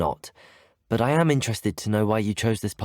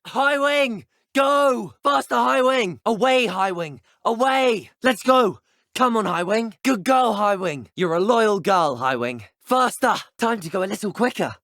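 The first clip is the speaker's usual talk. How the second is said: high, loud, urging shouts; calling out; 11 semitones higher, 4 dB louder than usual. Acted. Rider urging High Wing faster in flight, calling out. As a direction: shout your mount onward, pitched well up.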